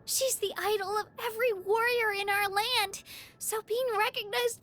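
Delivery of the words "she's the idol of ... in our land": normal talking voice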